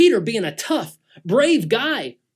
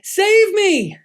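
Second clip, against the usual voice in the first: high, loud cry for help; desperate yell; 14 semitones higher, 7 dB louder, much slower than usual. Acted. Peter sinking in the waves cries to Jesus, desperate yell. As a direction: yell it while going under, much higher and louder. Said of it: "he yells out".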